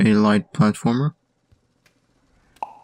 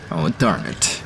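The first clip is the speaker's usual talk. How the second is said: German accent; 5 semitones lower, 4 dB quieter, slower than usual. Real, not acted. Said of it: "my German accent just slipped in".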